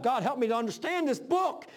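a man crying out to God for help; pleading prayer. Retold or imitated. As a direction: beg God for understanding, voice rising. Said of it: "I was crying out to God".

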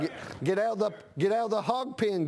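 ordinary talk

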